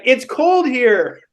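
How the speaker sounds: high, drawn-out crowd complaint; mock protest. Mimics crowd's objection to tank tops.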